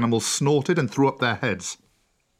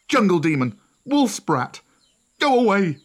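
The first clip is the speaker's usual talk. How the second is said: much higher, louder hostile shouting; louder, higher mob jeering; angry shout; 8 semitones higher, 4 dB louder than usual. A narrator's reading. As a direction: hurl the insults at him. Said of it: "shouted the villagers".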